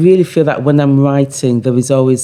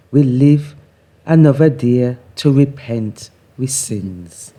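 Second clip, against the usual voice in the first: patois poem reading; 3 semitones higher than usual. Acted. Reads her patois poem aloud.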